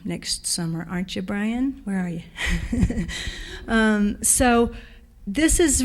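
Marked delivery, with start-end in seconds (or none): 2.3-3.6 short real laugh; brief laugh after teasing a friend; chuckle. Real, not acted. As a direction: laugh lightly to yourself. Laughs after calling out to Brian.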